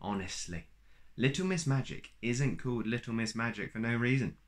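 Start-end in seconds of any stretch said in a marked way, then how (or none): none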